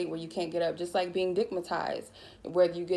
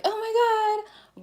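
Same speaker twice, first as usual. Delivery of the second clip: very high, loud phone-agent gush; fake customer service voice; 6 dB louder, much slower than usual. Shown as a demonstration. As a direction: stretch it out in an exaggerated, sky-high service voice. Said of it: "this fake customer service voice".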